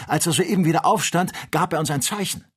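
hoch und laut jubelnd; frohlockend. A narrator's reading. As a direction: ruf es triumphierend aus. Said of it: "rief der Doktor frohlockend". (frohlockender Ausruf)

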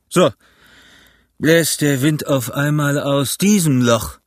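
slow, lightly voiced pirate leader; Long John, calling out. A narrator's reading. Long John answers the cheering crew, calling out slowly. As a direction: call it out slowly, with mild scorn. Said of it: "rief Long John".

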